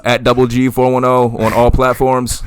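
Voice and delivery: slow, loud announcer voice; announcer plug. Acted. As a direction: boom it out like an ad. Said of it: "His voice sounds better".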